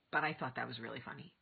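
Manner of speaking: normal talking voice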